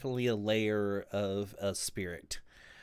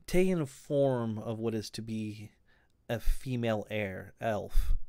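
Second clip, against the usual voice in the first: game master scene setting. A narrator's reading.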